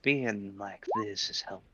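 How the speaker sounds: lightly voiced Dizzy character; a hint of the Dizzy character voice. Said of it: "You're still doing the Dizzy voice".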